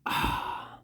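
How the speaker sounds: ordinary delivery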